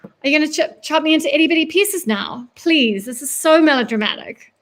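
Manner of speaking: scoffing, put-on boredom; feigned boredom. A narrator's reading. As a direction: taunt as if unimpressed by the threat. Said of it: "pretends to stifle a yawn".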